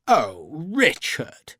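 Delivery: slow, drawn-out mild sigh of a gentleman; lightly voiced letdown aristocrat; disappointed. A narrator's reading. Lavisser chiding Sharp's joke, mildly disappointed. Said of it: "Lavisser sounded disappointed".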